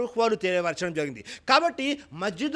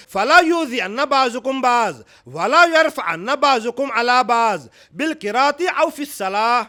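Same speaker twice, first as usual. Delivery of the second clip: louder, slower Arabic recitation; louder, slow Arabic hadith recitation; 7 dB louder than usual. Acted. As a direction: intone the Arabic slowly and loudly.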